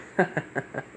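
acted airy, quiet chuckle; soft voiceless character chuckle. He laughs after making her scream, soft chuckle. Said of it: "he does chuckle".

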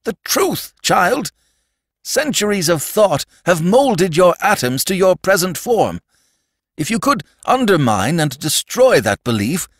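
high, impassioned pleading; frustrated Sandman. A narrator's reading. Sandman trying to make the child believe, frantic with frustration. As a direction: preach it urgently in desperate bursts. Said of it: "The Sandman gibbered with frustration".

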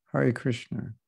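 Hare Krishna said softly with a foreign a and flat Krishna; imitated mispronunciation. Teacher mocking the devotees' pronunciation of Hare Krishna. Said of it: "They say Krishna".

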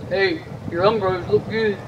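low, put-on schoolmate voice; schoolmate's compliment. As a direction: drop low to imitate another kid.

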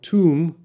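"tomb" with a long oo, B silent; oo-sound demo. Shown as a demonstration. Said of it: "this O has a oo sound".

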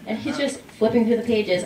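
ordinary talk, nothing marked

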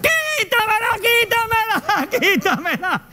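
high panicked pleading that fades into a laugh; frantic pleading. Retold or imitated. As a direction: beg them to get it off. Begging someone remove the bug.

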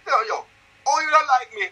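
mock yardie: yo yo, you don't like me; Jamaican yardie accent. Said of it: "Chris Eubank switched".

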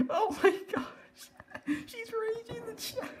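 very high squeal while laughing; helpless laughter. Real, not acted. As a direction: force the words out through giggles. Cracking up at raging player.

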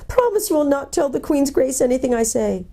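high pleading creature voice; whimpering. A narrator's reading. Frightened gnome asks captors not to tell the Queen, whimpering. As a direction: beg them in a high whimper. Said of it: "whimpered the gnome".

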